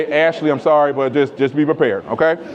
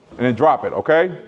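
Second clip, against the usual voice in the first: ordinary talk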